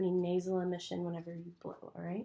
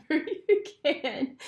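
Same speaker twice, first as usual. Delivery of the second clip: laughing; 7 semitones higher, 6 dB louder than usual. Real, not acted. Still giggling.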